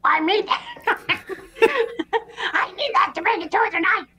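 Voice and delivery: squeaky rubber duck. Acted. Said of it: "you can do a duck voice".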